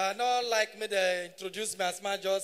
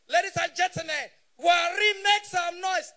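loud hype-man announcement, pitched up; MC shout; 7 semitones higher, 7 dB louder than usual. Retold or imitated. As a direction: bellow it like an MC working the crowd. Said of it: "Got the shout".

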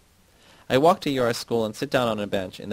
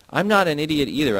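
higher, louder shout; 6 semitones higher, 6 dB louder than usual. A narrator's reading. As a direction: throw it at her, stung. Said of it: "I shout to Yasna".

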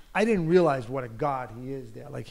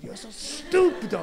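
much higher, louder, slow, drawn-out scorn; mock outraged butcher; 13 semitones higher, 4 dB louder, slower than usual. Butcher mocking the baker, high and outraged. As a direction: ham up the butcher's disgust.

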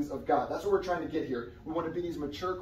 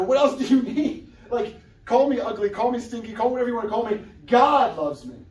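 higher and louder, laughing with excitement; real exuberant joy, louder on God loves me; joyful defiance; 7 semitones higher, 9 dB louder than usual. Real, not acted. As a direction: shrug off every insult with glee. Defiant joy about God's love.

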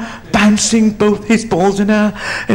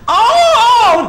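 loud, high drawn-out "oh"; Tarzan-style yell; 6 dB louder than usual. Acted. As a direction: belt out a high jungle cry. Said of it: "no, no, no, that was Tarzan".

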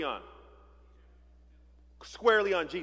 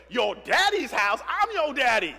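much louder, higher, outraged father; indignant Joseph shout; 5 semitones higher, 8 dB louder than usual. Acted. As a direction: bellow back like an offended dad. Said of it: "you can imagine Joseph saying".

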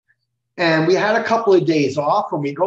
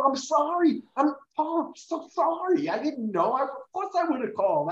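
higher and softer, fading sorry; contrite apology; 8 semitones higher, 6 dB quieter than usual. Retold or imitated. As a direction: apologise meekly, shrinking as you go. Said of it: "And Gene was so".

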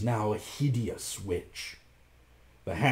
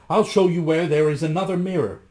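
louder, higher urging; calling out; 6 semitones higher, 7 dB louder, faster than usual. A narrator's reading. Rasmus coaxes his reflection, calling out loudly. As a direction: call after it with raised voice. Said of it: "cried Rasmus".

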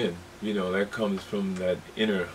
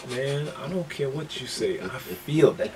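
higher-pitched, insistent retelling of a bandmate; lightly acted stubborn musician; insistent quote; 4 semitones higher than usual. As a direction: argue back with heartfelt conviction. Acts out George insisting on feel.

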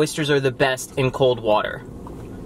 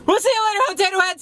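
high-pitched cheery goodbye; high sign-off; faster than usual. Real, not acted. Outro sign-off to viewers.

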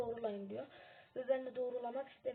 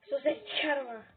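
sharp loud hush, higher; hushing shout; 3 semitones higher, 10 dB louder than usual. Real, not acted. Sneaking, hushing in game. (yüksek sesle susturma)

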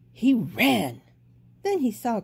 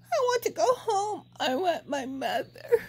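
put-on tearful baby bird; 10 semitones higher than usual. A narrator's reading. Bird lost and homesick.

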